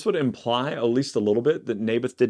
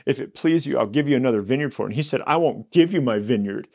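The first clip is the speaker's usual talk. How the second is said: whiny, higher king's plea, rushed along; 3 semitones higher than usual. Shown as a demonstration. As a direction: whine it like a spoiled king. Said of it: "I gotta sound like whiny, right?".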